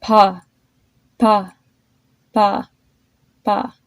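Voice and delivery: "pa" repeated, the p lighter each time; softening consonant. Shown as a demonstration. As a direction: shrink the puff of air on each "pa". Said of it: "let's try it with the letter P".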